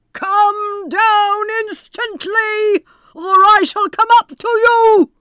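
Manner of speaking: very high, loud, slow roar; bawling Bluebeard. Acted. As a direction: bellow up the stairs to terrify. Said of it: "cried out as loud as he could bawl".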